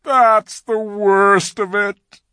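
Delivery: loud, slow, high-voiced moan; groaning. A narrator's reading. Faun answering Lucy about his pay, groaning. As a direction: groan out the words heavily. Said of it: "with a deep groan".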